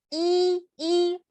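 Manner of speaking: I held high and level; first tone. Shown as a demonstration. Teacher showing first tone, i high and even. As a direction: sustain each "i" on one high note. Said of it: "primer tono".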